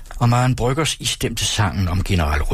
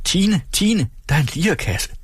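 high, eager calling out; mother calling Tine, high and delighted; excited call; 9 semitones higher than usual. A narrator's reading. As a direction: call the cook over, thrilled. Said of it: "og moren råbte".

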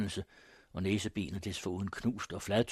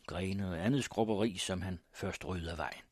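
ordinary delivery

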